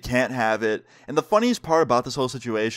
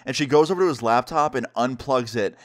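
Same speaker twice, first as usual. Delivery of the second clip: plain reading voice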